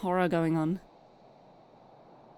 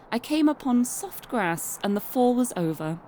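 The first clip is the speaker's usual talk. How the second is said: calm first-person storybook reading; story narration; 3 semitones higher than usual. A narrator's reading. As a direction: tell Alice's tale evenly. Long narration as Alice.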